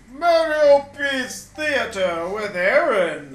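announcer voice. Acted. Announcer show intro.

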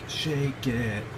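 normal talking voice

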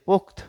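"পোক্ত" cut off on the final t; stopped final consonant. Shown as a demonstration. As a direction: say "পোক্ত" as pokt, no vowel at the end. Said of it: "এখানে কিন্তু আমরা এসে থেমে যাচ্ছি".

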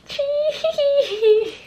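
high squealing giggle over something cute; delighted squeal-laugh. Real, not acted. Crafter squealing at tiny food, high laugh.

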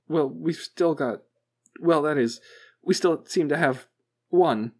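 high, loud, halting technician; hesitant technician. A narrator's reading. Technician reluctantly answering the boss, hesitant and high. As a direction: stall nervously before admitting it.

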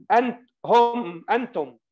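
ordinary delivery

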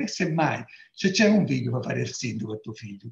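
ordinary talk

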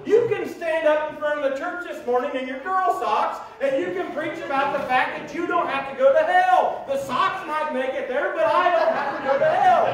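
loud, high, building joy; excited preaching. Real, not acted. Preacher exulting to his church that he is saved.

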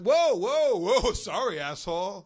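loud, high, huffy mock outrage; a hammed-up insulted fan storming off; offended stranger. The stranger snaps at Kato after his shrug, loud mock outrage. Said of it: "It's like".

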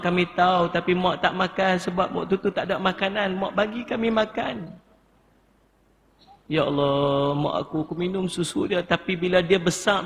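demonstrated tearful lament, pitch rising throughout; tearful pleading. Shown as a demonstration.

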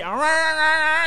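very high, loud mock whale moaning; whale-like babble. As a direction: mimic her drunken noise like a whale under water. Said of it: "sounding like whales under the water".

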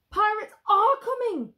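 high, loud frightened cry; panicked gasp. Acted. Villagers warning everyone the pirates are coming, panicked. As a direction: cry out in alarm. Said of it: "gasped the villagers".

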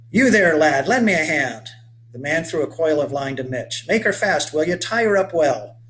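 rugged 1830s settler hailing a stranger; weathered old boatman. Acted. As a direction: call out to the boy like a sun-burned old sailor. Hails the boy from boat.

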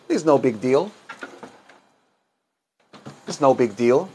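ordinary delivery

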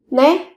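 né said with the pitch sliding up at the end; rising question tone. Shown as a demonstration. Teacher shows a yes that sounds like "what did you say?", rising. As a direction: lift né into a puzzled question. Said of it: "Còn khi mà các bạn lên giọng là né".

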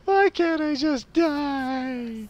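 high, very slow theatrical wail; mock wail. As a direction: wail it like a tragic hero.

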